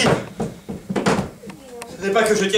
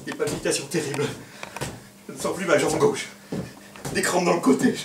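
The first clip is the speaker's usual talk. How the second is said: weak moaning; 6 semitones lower, 8 dB quieter than usual. Acted. Suitor moaning weakly.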